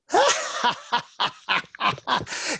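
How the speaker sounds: high mocking laugh; teasing laugh. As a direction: laugh right at him. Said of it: "So I laughed at him".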